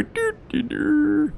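high mocking dur dur; dopey voice. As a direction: play it dumb and silly. Mocking dumb noise.

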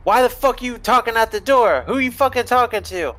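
put-on an unseen man yelling at the teen at the door, angry call; yelling from farther away. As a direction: shout it from the back room. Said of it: "hear somebody from farther away saying".

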